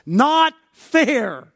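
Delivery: loud, high cry of protest; indignant objection. Acted. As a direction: object at full voice, stretching the key words. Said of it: "it rises up and says".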